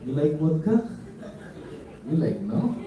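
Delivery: put-on playfully offering vodka in Russian accent; hint of a Russian host's accent; mock Russian accent. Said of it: "here in Russia".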